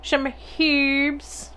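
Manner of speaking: "herbs" as "heebs", with a sounded H; playful mispronunciation. Pretend. Playing on how herbs is said.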